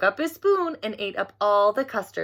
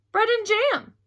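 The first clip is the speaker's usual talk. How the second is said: put-on 1 little girl voice; 11 semitones higher than usual. A narrator's reading. Frances answers Albert.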